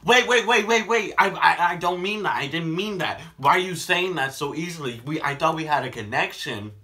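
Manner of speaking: loud high pleading; desperate pleading. Retold or imitated. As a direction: beg frantically. Voices pleading guy.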